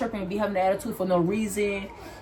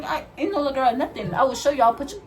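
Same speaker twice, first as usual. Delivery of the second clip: normal talking voice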